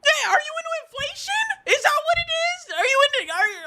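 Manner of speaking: very high, squealed questions; high-pitched disbelief breaking toward laughter; incredulous questioning. Real, not acted. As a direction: demand an answer in a high squeal.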